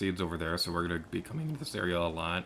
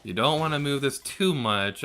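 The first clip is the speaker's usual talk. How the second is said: usual speaking voice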